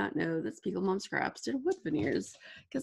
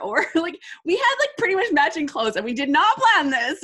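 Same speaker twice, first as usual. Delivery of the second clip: laughing disbelief; 8 semitones higher, 8 dB louder than usual. Real, not acted. Laughing about matching outfits.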